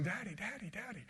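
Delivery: a hint of a child's tearful plea; crying for daddy. Boy on the porch begging his leaving father, softly tearful. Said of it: "I was crying, saying".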